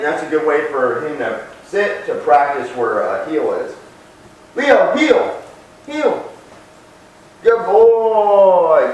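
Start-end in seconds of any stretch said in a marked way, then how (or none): 4.6-8.9 much higher, louder call and praise; dog commands. Real, not acted. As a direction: call Leo to heel high and loud. Commanding then praising Leo.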